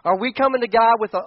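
ordinary delivery

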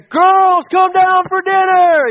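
high, loud, slow call up the stairs; yelling for dinner. Retold or imitated. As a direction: stretch it out, calling the girls down. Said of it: "yelling and banging".

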